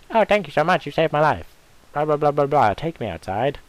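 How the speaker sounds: high, loud, gushing mock rescued girl; a squealing, over-the-top damsel parody. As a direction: ham up a helpless girl thanking her rescuer.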